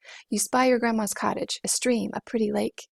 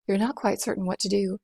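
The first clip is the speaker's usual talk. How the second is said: ordinary delivery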